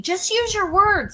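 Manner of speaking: put-on frustrated parent screaming at a stumbling child. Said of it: "instead of screaming".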